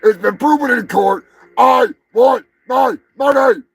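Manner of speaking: loud, high furious shouting; real furious outburst; angry rant. Real, not acted. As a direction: yell it out, fed up and raging. Ranting about unpaid money.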